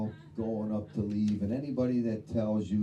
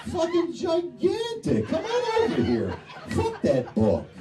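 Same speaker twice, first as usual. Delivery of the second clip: excited shout; 5 dB louder than usual. Retold or imitated. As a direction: yell it out in giddy amazement. Retold excited shout to wife.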